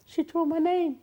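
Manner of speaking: hammed-up shocked complaint. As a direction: play him stunned and hurt. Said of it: "he was shocked".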